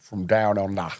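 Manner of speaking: put-on Australian accent; mock Australian twang. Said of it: "Is that Australian?".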